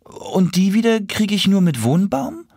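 high, incredulous little animal voice; reddish animal character. Acted. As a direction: pitch it up, lilting at the ends.

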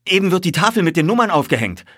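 high, loud, quick exclamation; calling out. A narrator's reading. Narrator reports the number board going up, calling out. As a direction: call out the news excitedly. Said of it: "rief ich".

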